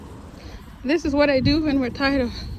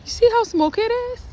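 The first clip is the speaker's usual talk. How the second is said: excited; 8 semitones higher, 4 dB louder than usual. Real, not acted. Pointing out mist excitedly.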